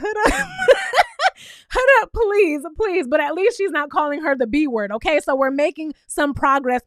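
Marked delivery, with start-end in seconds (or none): 0.0-3.1 real laughter through the words; laughing plea. Real, not acted. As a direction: beg her to stop while cracking up. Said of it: "I'm like".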